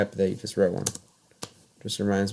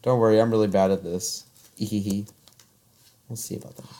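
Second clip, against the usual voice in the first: ordinary talk, nothing marked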